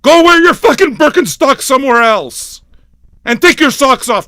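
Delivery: loud, high-pitched ranting yell. Real, not acted. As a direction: yell them out of your sight. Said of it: "I love yelling".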